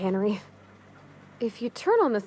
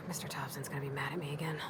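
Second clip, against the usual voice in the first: whispered fast under her breath, much softer; worried whisper; 7 semitones lower, 13 dB quieter, faster than usual. Acted. Mechanic frets to herself about her boss, whispering. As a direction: hush it to yourself like you fear being overheard.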